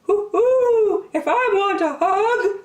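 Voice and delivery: high hooting owl voice; wise owl. Acted. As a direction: hoot like a wise old owl. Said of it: "said a wise owl".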